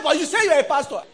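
imitating the driver's cheeky rebuke; mock reproach. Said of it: "Do you know what he said to me?".

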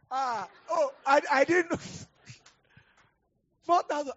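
high, loud, laughing disbelief; giddy disbelief at four thousand dollars; laughing excitement. Real, not acted. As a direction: blurt it out giggling with shock. Excited about the money.

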